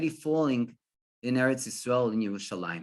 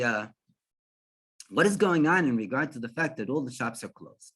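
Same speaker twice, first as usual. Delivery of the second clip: ordinary delivery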